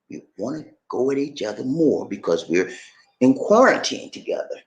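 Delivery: ordinary delivery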